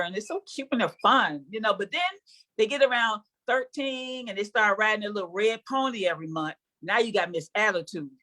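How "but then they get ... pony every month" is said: ordinary talk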